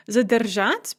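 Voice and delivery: Задержать with the stress on its last syllable; stress on final syllable. Shown as a demonstration. Teacher reviewing infinitives, final syllable stressed in Задержать. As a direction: land the stress of Задержать on -жать. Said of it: "if the stress is on the at".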